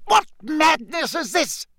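very high outraged question; appalled, high-pitched outburst; shrieking. A narrator's reading. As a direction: cry out in scandalised disbelief. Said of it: "Fudge shrieked".

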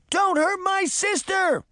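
put-on very high, drawn-out little-girl yell; high-pitched pleading shout; desperate yell. A narrator's reading. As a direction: yell to protect your sister. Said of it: "Daphne yelled".

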